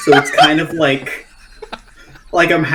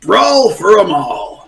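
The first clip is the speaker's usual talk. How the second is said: slow, louder tough catchphrase; wrestler catchphrase; 4 semitones higher, 3 dB louder, slower than usual. Pretend. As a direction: growl it like a wrestler's tagline. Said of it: "what would Steel Fist's sort of tagline be".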